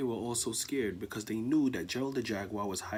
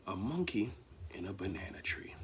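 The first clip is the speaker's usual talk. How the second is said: whispered reveal; 5 semitones lower, 9 dB quieter than usual. A narrator's reading. Storyteller whispering the surprise to listeners. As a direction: hush it like a secret.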